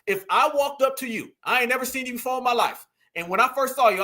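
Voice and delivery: ordinary talk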